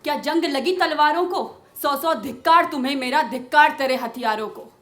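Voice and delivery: loud, high-pitched indignant verse; Draupadi's ringing, scornful rebuke in verse; angry declamation. Acted. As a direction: hurl the reproach at your warrior husbands. Draupadi berates her warrior husbands.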